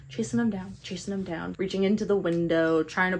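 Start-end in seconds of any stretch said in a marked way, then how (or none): 0.1-1.6 hushed. Acted. Hushed dramatic repeat of story.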